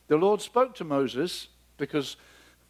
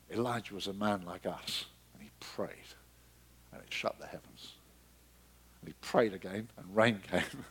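dropped to a much softer, lower near-whisper; hushed awe; 8 semitones lower, 9 dB quieter than usual. Real, not acted. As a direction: lean in and hush it with reverence. Awed hushed retelling.

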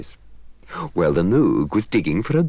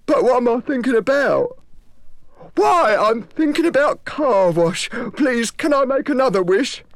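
overjoyed Moog; 4 dB louder than usual. Acted. The Moog thrilled to be thinking, very high. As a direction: marvel at your own thoughts.

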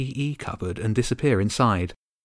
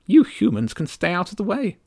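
high, curt and dismissive; sharp tiny countess; 9 semitones higher, 4 dB louder than usual. Acted. Countess telling the human boy to keep out, sharp. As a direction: snap him back in his place. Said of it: "said sharply".

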